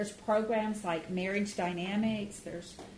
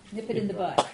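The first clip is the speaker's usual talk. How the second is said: light mock of a jumpy deputy, louder; a quick, hint-of-deputy catchphrase; Barney Fife; 6 dB louder, faster than usual. Wife quoting a TV deputy's catchphrase to the class, mock-louder. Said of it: "call it the Barney Fife method".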